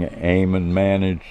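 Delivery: plain reading voice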